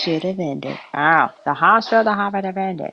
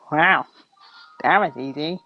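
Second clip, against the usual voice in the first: ordinary delivery, nothing marked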